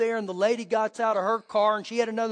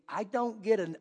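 ordinary talk, nothing marked

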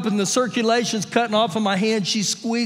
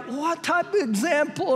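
lightly imitated disapproving wife, higher and softer; Shelley; 6 semitones higher, 3 dB quieter than usual. Wife to husband after the whistle, imitated disapproval. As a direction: voice a reproving wife in a raised pitch. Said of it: "Shelley looks at me like".

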